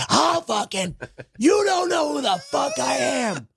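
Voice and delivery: high, strained mock shout; acted furious threat. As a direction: yell it as a hammed-up street-fight threat. Acted threat in street fight.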